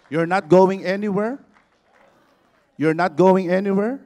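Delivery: loud call to the congregation; shouted congregational rally; rousing altar call. Acted. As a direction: fire the crowd up.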